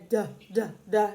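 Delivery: short, separate "d" sounds; letter sound demo. Shown as a demonstration. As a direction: sound out the letter d as repeated little bursts. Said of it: "what sound does that D make".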